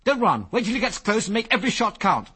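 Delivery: high, loud shouted orders; urgent commands. A narrator's reading. Biggles ordering Ginger as the bear charges, loud. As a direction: shout orders over the danger.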